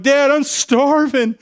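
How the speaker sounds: put-on high, slow, whining kid voice; whiny child. Little ones whining to their dad that they're starving. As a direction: stretch it out like a hungry little kid.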